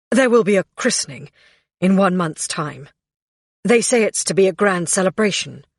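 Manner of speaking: fast, words running together; rushed. A narrator's reading. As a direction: rush it out nervously. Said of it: "his words running into one another".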